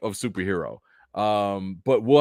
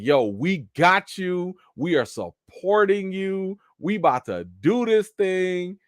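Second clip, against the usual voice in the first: slow, high-pitched mock excitement; hammed-up geeked cheering; geeked parents, imitated; 7 semitones higher, slower than usual. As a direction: stretch it out like over-eager supportive parents. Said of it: "They so geeked".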